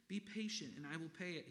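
high, desperate plea; pleading. Acted. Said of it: "he pleaded".

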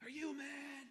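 high, put-on goading devil voice; mock devil stirring up anger; Satan goading. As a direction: taunt him, egging him on. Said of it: "he just wants to say".

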